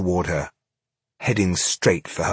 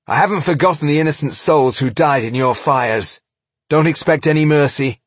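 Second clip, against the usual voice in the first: loud, high, merciless threat; vengeful dwarf; 7 semitones higher, 5 dB louder than usual. Acted. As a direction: promise no mercy, raised voice. Tungdil threatens the Eoil.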